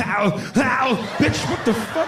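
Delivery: high, yelping "ow, ow" jungle-warrior cry; high Predator call. Acted. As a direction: let out the Predator call and then snap at it. Performing the Predator noise.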